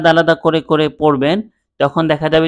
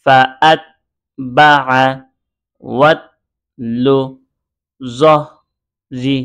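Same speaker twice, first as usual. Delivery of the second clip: joining sounds. Shown as a demonstration. Teacher shows students how to join letter sounds together. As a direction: link each letter to the next, with short gaps. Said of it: "একটা হরফের সাথে আরেকটা হরফ যুক্ত করেন".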